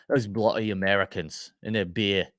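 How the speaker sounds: put-on a lightly done British accent that slides into Aussie on beer; mock British accent. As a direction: grumble like a Brit, with an Aussie slip on beer. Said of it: "it was Aussie when I said beer".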